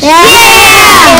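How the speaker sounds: loud, high cheering shout; shouted team celebration; excited cheer. Acted. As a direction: cheer for your teammate. Players cheer team announcement.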